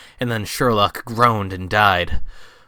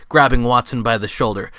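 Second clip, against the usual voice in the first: usual speaking voice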